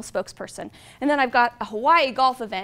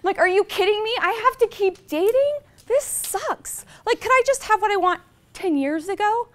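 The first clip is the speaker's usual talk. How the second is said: higher, louder exasperated whining; fed-up complaining, higher and louder; frustrated; 7 semitones higher, 4 dB louder than usual. Retold or imitated. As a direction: vent the dating frustration, pitched up. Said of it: "sometimes I'm so frustrated".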